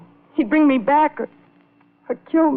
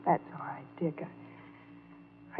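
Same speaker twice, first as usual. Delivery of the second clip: softer, unvoiced whisper breaking off; a whispered resignation to her friend; 2 semitones lower, 17 dB quieter, much slower than usual. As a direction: let it fall to a bare whisper, resigned.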